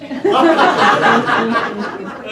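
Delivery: bursting into loud laughter. Real, not acted. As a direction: crack up at your own joke. Teller laughs at own punchline.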